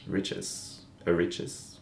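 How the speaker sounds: losing voice. Real, not acted. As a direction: voice giving out to a whisper. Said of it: "I'm losing my voice".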